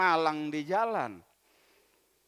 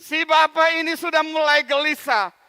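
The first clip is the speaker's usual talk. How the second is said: ordinary delivery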